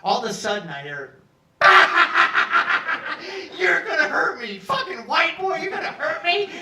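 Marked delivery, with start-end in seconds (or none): 1.6-3.5 huge high roaring belly laugh; booming laugh. Retold or imitated. Cellmate bursting into roaring laughter at the threat. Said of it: "that was his laughter". 3.6-6.5 high loud words through laughter; mock the threat while cracking up; laughing shout. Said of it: "that was his laughter".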